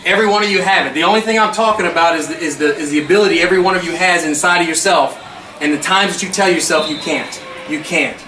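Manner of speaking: loud, low fired-up coach; halftime pep speech. Real, not acted. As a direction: preach it to the team at full force.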